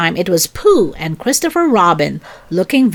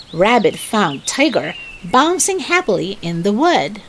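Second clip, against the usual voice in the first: ordinary delivery, nothing marked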